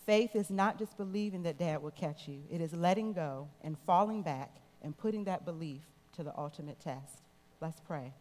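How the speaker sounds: ordinary delivery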